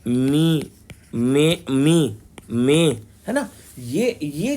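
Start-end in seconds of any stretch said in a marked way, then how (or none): 1.1-2.9 मे, मी, मे drawn out very slowly; matra demo. Shown as a demonstration. Teacher spelling out vowel signs for the class, very slow. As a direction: stretch each vowel sign sound. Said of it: "जैसे मो".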